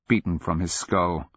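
plain reading voice

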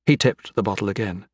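ordinary delivery

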